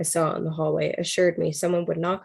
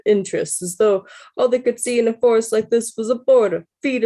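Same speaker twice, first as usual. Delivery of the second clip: amused, higher Brooklyn voice cracking into laughs; Brooklyn accent; 4 semitones higher, 4 dB louder than usual. Acted. Hiker marvels at the forest in a giggly Brooklyn accent. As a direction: lecture about old trees in a Brooklyn accent. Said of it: "How's, how's my Brooklyn accent, guys?".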